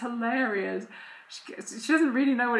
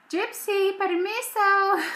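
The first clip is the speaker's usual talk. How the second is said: put-on toddler voice; 12 semitones higher, 6 dB louder, slower than usual. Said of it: "she'll be like".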